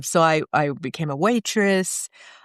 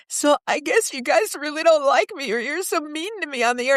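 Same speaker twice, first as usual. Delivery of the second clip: higher, wounded complaint to her boss; hurt young DJ; 10 semitones higher than usual. Retold or imitated. Calling Stern's hotline, hurt and higher. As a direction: voice herself as a hurt newcomer calling in. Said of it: "and I said".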